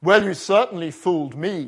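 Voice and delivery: loud booming Grim Reaper voice; deep booming voice. Acted. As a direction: boom it out like Death himself. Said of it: "a deep voice boomed behind him".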